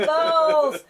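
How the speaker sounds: very loud, high, drawn-out yell; playful shout. Pretend. Host shouting over a friend's swearing, gleeful yell. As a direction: holler it like a silly swear-word cover.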